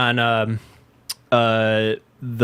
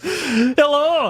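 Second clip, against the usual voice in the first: laughing mock hello; 12 semitones higher than usual. Parody of presenter, laughing.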